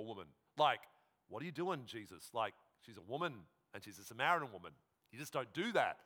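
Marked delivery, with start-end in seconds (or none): none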